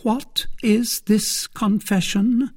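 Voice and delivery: high, slow, uneasy question; dread-tinged, slow demand; apprehensive. A narrator's reading. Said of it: "growing paler".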